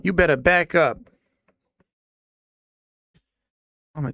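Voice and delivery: put-on British accent, barking threats; loud threat. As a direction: menace him with the axe. Axe man threatening the speaker.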